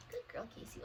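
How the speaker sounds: soft, high, breathy whisper of praise. Real, not acted. Trainer praising Casey in a soft, high whisper.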